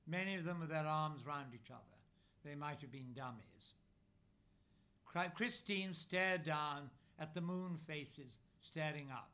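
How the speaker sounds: ordinary delivery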